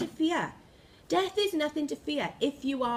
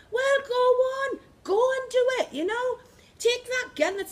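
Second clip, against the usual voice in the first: defiant dare; 10 semitones higher, 4 dB louder than usual. Acted. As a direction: dare them to go ahead. Defiant retort to a threat.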